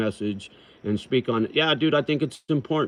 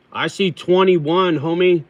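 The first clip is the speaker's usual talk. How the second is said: excited; 7 semitones higher, 7 dB louder than usual. Real, not acted. Hyped count of live viewers.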